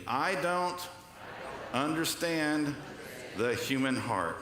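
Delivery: slow, broken into short phrases with pauses; feed it phrase by phrase for repeating; repeat-after-me. Shown as a demonstration. Preacher leading the congregation to repeat after him, slow and spaced out. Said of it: "So everybody repeat after me".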